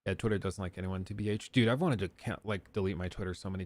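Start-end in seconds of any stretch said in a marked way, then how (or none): none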